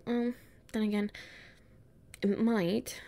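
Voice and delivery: ordinary talk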